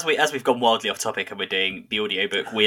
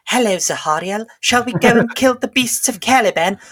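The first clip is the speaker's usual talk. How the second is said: high, hammed-up noble knight voice; pompous knight; 10 semitones higher than usual. Mocking the Dark Angels audiobook, a hammed-up noble knight. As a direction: overplay the stiff heroic greeting. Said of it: "they spend the whole time just going".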